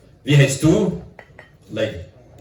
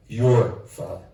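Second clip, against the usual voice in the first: "Your father." said formally, as if to a king; courtly, respectful "Your father."; elegant address; much slower than usual. Shown as a demonstration. Showing how Italians address a king, elegant and formal. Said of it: "a very elegant way how to say".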